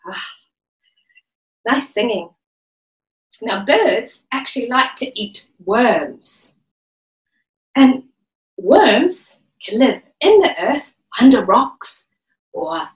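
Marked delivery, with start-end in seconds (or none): none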